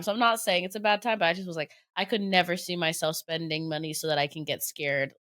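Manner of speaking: ordinary talk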